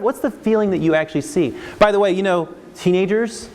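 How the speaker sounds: usual speaking voice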